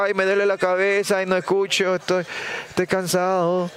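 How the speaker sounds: high-pitched, put-on moaning excuses; mock ailing complaint. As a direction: whine like someone faking aches to be left alone. Mimics reluctant, ailing God turning away. (queja fingida de enfermo, con voz aguda)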